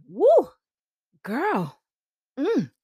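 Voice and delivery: wowed, with swooping high whoops and a pleading finish; excited admiring husband. Retold or imitated. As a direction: play a husband bowled over by his wife's new look. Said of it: "He's excited".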